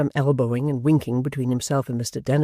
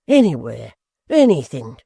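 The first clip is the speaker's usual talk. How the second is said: louder, reckless cry; cried out; 7 dB louder than usual. A narrator's reading. Hugh answers Gashford with an impatient loud cry. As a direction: burst out impatiently. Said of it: "cried Hugh".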